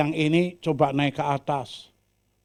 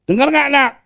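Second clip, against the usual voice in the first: father asking; 13 semitones higher, 11 dB louder than usual. Acted. Father asks son.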